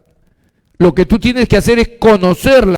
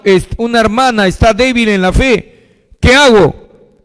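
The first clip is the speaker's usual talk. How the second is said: usual speaking voice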